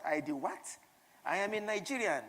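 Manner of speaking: loud, proud self-introduction; proud declaration. Retold or imitated. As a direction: declare it with swagger. Retold boast to Kenyan receptionist.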